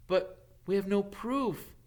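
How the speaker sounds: lightly voiced anxious schoolboy; Harry. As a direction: argue it gloomily.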